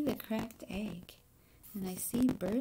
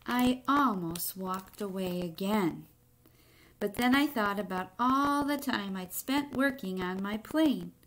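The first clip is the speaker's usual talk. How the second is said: put-on expressive read-aloud to children; story reading; 7 dB louder, slower than usual. A narrator's reading. Teacher reading a picture book to the class, expressive narration. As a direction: tell the egg's story to the class, lightly dramatic.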